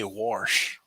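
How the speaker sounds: "warsh" with an r sound before the sh; Pittsburgh "warsh"; warsh for wash. Retold or imitated. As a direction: say "warsh" like an older man from Pittsburgh. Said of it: "he would always say".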